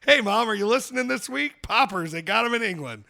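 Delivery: loud, high shout-out; calling out to mom. Pretend. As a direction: holler it playfully across to your mother.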